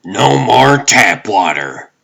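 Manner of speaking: high, loud warning; paranoid roommate. Acted. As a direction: declare the rule with paranoid insistence.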